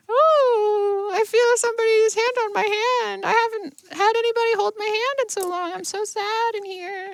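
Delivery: high, lonely spirit voice that sinks to a hush; high trapped-man voice. Acted. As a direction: voice the lost man from far inside. The trapped man's first words.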